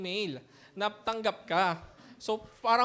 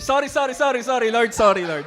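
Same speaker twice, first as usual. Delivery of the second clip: laughing apology; 6 dB louder than usual. Real, not acted.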